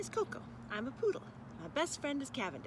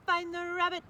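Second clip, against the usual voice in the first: higher, louder robot voice; 7 semitones higher, 6 dB louder than usual. Acted. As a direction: bark it like a toy robot. Robot dog commands.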